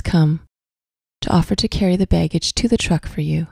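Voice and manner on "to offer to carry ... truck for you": ordinary talk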